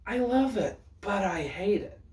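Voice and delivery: high, loud diva verdict; sassy drag queen. Real, not acted. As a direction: serve it with full sass. Dramatic verdict on drag look.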